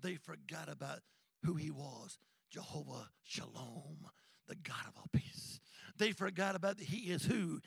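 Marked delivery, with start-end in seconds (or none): 2.5-5.6 hushed, soft and low; whisper. Acted. Preacher hushing the congregation, whispered. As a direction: drop to a whisper. Dramatic sermon hush.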